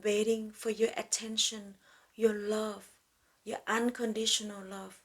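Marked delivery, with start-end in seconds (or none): none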